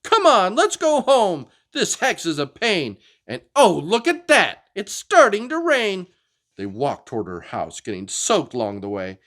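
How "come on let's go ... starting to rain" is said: high, loud fed-up whine; a hexed dinosaur girl losing patience; angry Anda. Acted. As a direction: storm off complaining.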